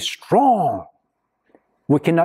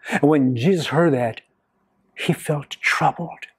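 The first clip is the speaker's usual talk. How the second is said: usual speaking voice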